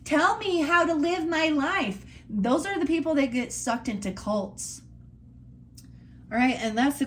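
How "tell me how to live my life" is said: high, whiny mock follower voice; mock plea in a high whine; mock sheep voice. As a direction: sneer it like a needy sheep. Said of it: "They're the ones going".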